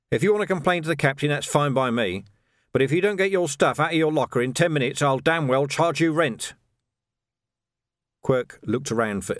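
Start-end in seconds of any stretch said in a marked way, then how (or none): 0.1-6.5 brisk, higher-pitched dismissal; no-nonsense superior, quick and firm; stern sergeant. Acted. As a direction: send him packing at a fast clip. Vimes dismisses the corrupt corporal.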